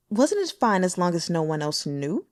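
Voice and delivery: ordinary delivery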